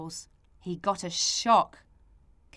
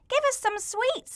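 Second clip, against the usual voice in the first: put-on high demanding child voice. Maisie demanding sweets from Mister Sniffer. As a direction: ask for sweets in a high little voice. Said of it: "said Maisie".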